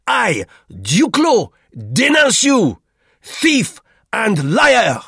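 put-on high, shouted French-accented accusation; furious denunciation. A narrator's reading. Duclos denounces Roux as thief, furiously. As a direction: denounce him grandly at the top of the voice.

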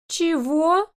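high, sharp disbelief; shocked "what?". A narrator's reading. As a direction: shoot up in outrage. Ellie shocked.

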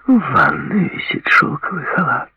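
high, purring voice. A narrator's reading. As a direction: purr the words invitingly. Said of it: "промурлыкала она".

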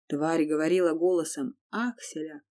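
usual speaking voice

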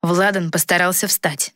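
ordinary delivery, nothing marked

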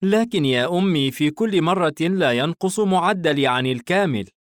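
put-on high-pitched boy pleading his case; young son. Son hugging his mother as he reassures her, high boy voice. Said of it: "الابن محتضنا والدته".

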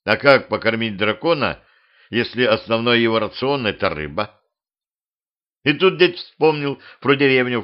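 ordinary delivery, nothing marked

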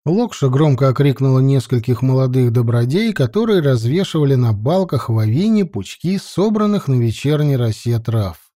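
plain reading voice

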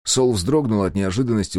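ordinary delivery, nothing marked

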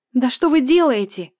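high, loud panicked scream at two men fighting. A narrator's reading. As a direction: yell at them to stop. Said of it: "кричит Лиза".

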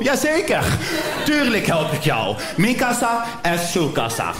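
high, slow, eager-to-please yes; lightly hammed-up overeager helper. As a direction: gush like a helpful fool. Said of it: "zeg ik met mijn domme kop".

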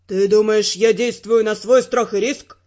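low male voice, stern; stern Ferrant. Acted. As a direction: turn it back on her.

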